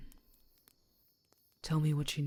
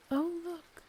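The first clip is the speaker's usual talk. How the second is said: playful surprise; 13 semitones higher than usual. Acted. Sudden playful discovery, pitch jumps.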